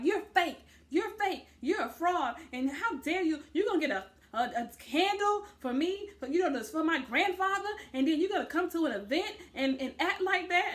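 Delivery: hammed-up drunk tirade at a castmate; mock angry rant. Drunk castmate yelling accusations.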